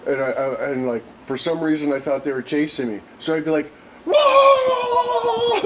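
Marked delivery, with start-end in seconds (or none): 4.1-5.6 high, loud yelp of alarm; startled whoa. Retold or imitated. Reliving a stoned run from friends, loud high whoa. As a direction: yelp as if spooked by a chase. Retold fleeing while stoned.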